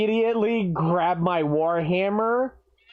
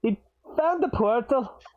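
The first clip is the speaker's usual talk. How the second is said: put-on Scottish dwarf accent; 4 semitones higher than usual. Dwarf introduces himself and his late father, Scottish accent. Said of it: "I don't know why I'm doing this accent".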